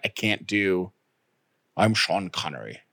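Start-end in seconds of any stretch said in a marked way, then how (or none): 1.8-2.8 low, soft attempt at a Scottish accent; badly faked Scottish accent, pitched down; Sean Connery. Co-host tries Scottish for a joke, low and amateur. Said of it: "something in a Scottish accent".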